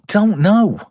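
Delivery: high-pitched "know", falling at the end; high bewilderment. Real, not acted. Reviewer puzzling over Reeve's fee, high bewilderment on "know". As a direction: throw "I don't know" up high. Answers own budget question, first take.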